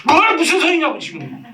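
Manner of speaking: high-pitched, hammed-up outraged denial; indignant denial. Balding man answers the hairdresser who mentions hair loss, indignant denial. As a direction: act out flat refusal with a sudden high outburst. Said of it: "막 부정하죠".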